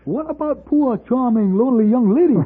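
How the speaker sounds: put-on poor charming lonely young lady; teasing. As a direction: needle him about the lady.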